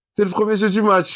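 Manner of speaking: high excited call; excited exclaim. Real, not acted. Player calling out the first play, excited. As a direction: cheer it out like a big moment.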